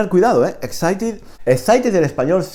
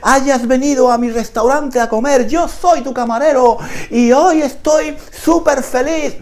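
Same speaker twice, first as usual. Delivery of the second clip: overexcited waiter; 12 semitones higher, 3 dB louder than usual. Pretend. Teacher mocking an imagined overexcited Spanish waiter, high and gushing. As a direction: ham up a thrilled waiter. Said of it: "No te dice".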